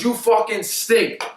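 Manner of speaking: high, slow, drawn-out put-down; retold coach's insult. Coach berating player before recruits, retold angry insult. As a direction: imitate a disgusted coach, stretching the words.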